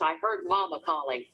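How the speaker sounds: ordinary delivery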